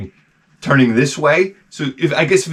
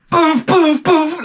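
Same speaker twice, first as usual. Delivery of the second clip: mock poof sounds; 14 semitones higher, much slower than usual. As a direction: pop each poof up high. Fingers sound effect.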